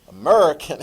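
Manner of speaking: loud, exaggerated American; American accent. Shown as a demonstration. Teacher naming the language we speak, exaggerated American accent. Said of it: "they speak American".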